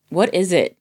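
usual speaking voice

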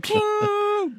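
very high pitched ping; high ping sound. Retold or imitated. Imitating a game sound effect.

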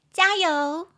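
high, loud claw-machine jingle voice; claw-machine cheer. Shown as a demonstration. Teacher shows students her claw-machine cheer, high and loud. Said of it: "很像夹娃娃机".

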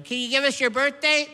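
high, loud clerk question; pharmacy clerk. Acted. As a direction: ask it across the counter. Walgreens clerk asking.

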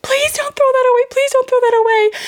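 put-on high, pleading child's cry; high-pitched tearful plea of a little girl; imitating sobbing child. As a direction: mimic a three-year-old begging through sobs.

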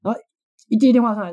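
ordinary talk, nothing marked

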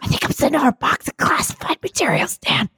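frantic unvoiced whisper; panicked whisper. Panicked whisper while hiding.

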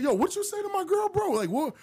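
mock confrontation with the guy, fast and high; confrontational.

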